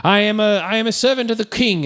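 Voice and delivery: lofty, high heroic declaration; lightly hammed gallant vow; gallant speech. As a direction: proclaim it like a noble hero. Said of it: "gallant speech that D'Artagnan makes".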